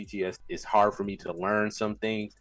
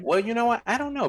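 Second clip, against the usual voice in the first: much higher, bursting in; surprised outburst; 4 dB louder than usual. Real, not acted. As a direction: jump in with sudden surprise. Surprised at the question.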